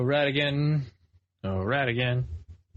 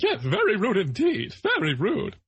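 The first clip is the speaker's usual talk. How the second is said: high, prim mock-British voice; higher, quicker hammed-up Hatter; Mad Hatter impression; 7 semitones higher than usual. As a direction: ham up a fussy Mad Hatter. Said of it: "And the Mad Hatter's like".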